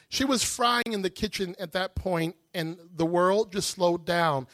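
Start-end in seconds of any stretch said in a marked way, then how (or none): none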